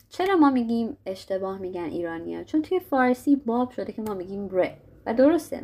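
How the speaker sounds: ordinary talk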